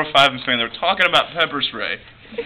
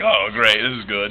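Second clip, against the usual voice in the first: lower, softer dry sarcasm; sarcastic; 7 semitones lower, 4 dB quieter than usual. Retold or imitated. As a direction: say it deadpan, sarcastic. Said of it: "I'm like".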